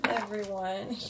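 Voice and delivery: ordinary talk, nothing marked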